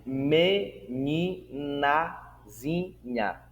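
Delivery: very slow, split into separate syllables. Shown as a demonstration. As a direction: say MENINAZINHA one syllable at a time. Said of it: "Como na palavra".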